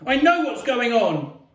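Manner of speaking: high, loud furious protest; shrieking. A narrator's reading. Boy demanding not to be babied, shrieking. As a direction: shriek each line in protest. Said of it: "I shrieked".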